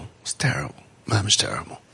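hushed whisper on "terrible"; a stage whisper of dismay. Real, not acted. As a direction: whisper it gravely.

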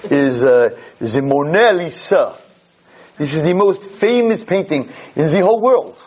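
slow, hammed French tour guide; mock French accent. Frenchman presenting the Mona Lisa, lightly mock French accent. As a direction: roll it out like a proud French guide. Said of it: "I'll do my little bit in French".

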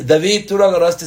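put-on mother scolding her little boy over the phone; mother yelling. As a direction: accuse the child sharply. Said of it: "empieza a gritar".

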